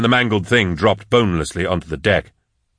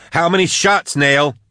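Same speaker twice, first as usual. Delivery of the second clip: high, urgent acted roar; heated shout, held back in volume; 8 semitones higher than usual. A narrator's reading. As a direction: demand an answer in a raised, high voice. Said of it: "Max roared".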